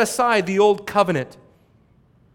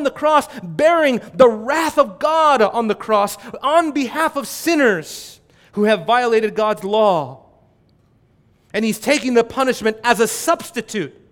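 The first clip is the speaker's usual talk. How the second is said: higher, louder pulpit fervour; fervent preaching; 4 semitones higher, 5 dB louder than usual. Acted. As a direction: preach it out to the congregation. Preaching at full force.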